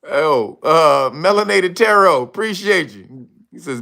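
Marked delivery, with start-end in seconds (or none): none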